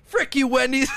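high, laughing mock insult; mock outraged customer. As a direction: curse them out while cracking up.

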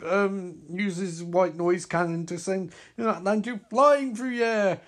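normal talking voice

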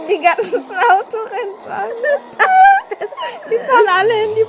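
high, loud laughing through the words; real helpless laughter; laughing hard. Real, not acted. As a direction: crack up while saying it.

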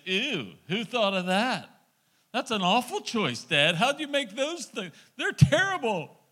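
lightly voiced whining child; picky kid. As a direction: play the fussy child turning up his nose. Dad voicing picky kids.

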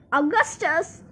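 mild storybook shout; understated mother's shout. A narrator's reading. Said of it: "shouted Miss Gloop".

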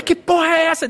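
high mock telling-off; hammed-up angry reprimand; retold scolding. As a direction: scold the animal indignantly. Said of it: "Deu um esporro na égua".

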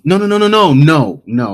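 high, loud rapid-fire string of no's; urgent interruption. Real, not acted. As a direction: cut in loudly to halt your own story. Cutting in to correct the recap.

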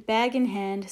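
plain reading voice

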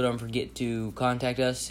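ordinary talk, nothing marked

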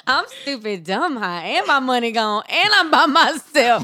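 building, laughing rant. Pretend. Voicing the singer, escalating.

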